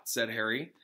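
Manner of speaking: ordinary delivery, nothing marked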